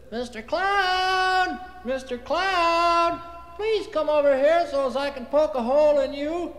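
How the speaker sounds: high, slow drawn-out call to the sky. Acted. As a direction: call far up to the cloud. Calling up to cloud.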